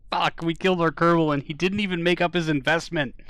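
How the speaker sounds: high, loud outburst of dismay; upset shouting. Real, not acted. Player reacting to the Kerbal's death, loud dismayed outburst. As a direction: cry out loudly over the loss.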